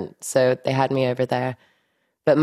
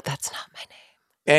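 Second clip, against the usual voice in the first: retold whisper; 4 dB quieter, slower than usual. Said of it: "I've had to be like".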